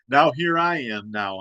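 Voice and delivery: ordinary talk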